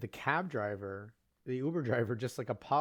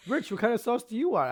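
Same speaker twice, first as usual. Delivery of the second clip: high, loud impatient ask; exasperated question; 11 semitones higher, 6 dB louder than usual. Retold or imitated. Jen pressing Rich about sauce, high and loud. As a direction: demand an answer from Rich. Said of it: "and then Jen goes".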